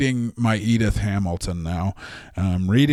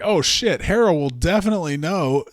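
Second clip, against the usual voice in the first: mock panic; 6 semitones higher than usual. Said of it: "panics again and is like".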